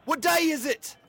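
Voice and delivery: high excited shout of the question; calling out. Acted. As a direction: yell it down to the street. Calling to girl.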